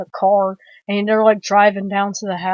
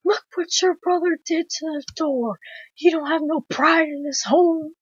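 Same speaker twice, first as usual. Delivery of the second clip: mock yelling cook; 7 semitones higher than usual. Film fan as cook yelling at hitchhiker, high mock yell. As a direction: imitate an old man's indignant yell at moderate volume. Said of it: "he yells at the hitchhiker".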